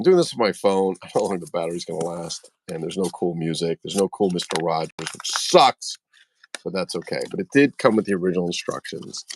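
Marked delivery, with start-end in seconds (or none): none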